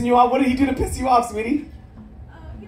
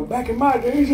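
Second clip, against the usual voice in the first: put-on old-timer voice; 2 semitones higher than usual. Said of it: "I'm gonna go to people".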